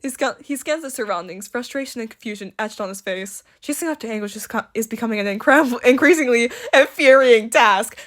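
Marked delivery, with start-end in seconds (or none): none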